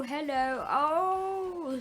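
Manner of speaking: usual speaking voice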